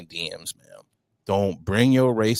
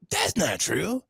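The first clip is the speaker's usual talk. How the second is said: mocking defender. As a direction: whine the excuse like a smug defender.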